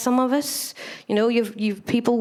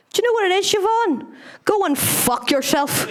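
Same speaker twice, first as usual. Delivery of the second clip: a much higher, lightly voiced old granny; granny swearing at daughter; 8 semitones higher than usual. Eighty-one-year-old granny telling her daughter off, lightly voiced high. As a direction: sweetly lift it, then drop the bomb. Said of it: "turned around to her only daughter and said".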